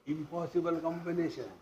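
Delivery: ordinary delivery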